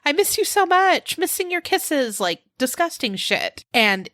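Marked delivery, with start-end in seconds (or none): 0.1-2.2 high, lovey-dovey gush; sickly-sweet texting lover, mockingly high; mock gushing. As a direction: lay on the syrupy sweetness, pitched high. Mocking gushing love texts.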